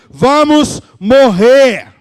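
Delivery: loud, high, drawn-out cry of the disciples; acted despairing yell; desperate shout. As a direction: shout it slowly as doomed men on a sinking boat. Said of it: "começam a gritar desesperados".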